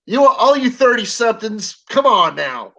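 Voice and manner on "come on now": ordinary talk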